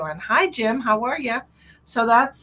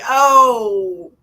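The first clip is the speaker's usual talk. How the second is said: acted realisation; 2 semitones higher, 10 dB louder than usual. Acting out her sudden realisation.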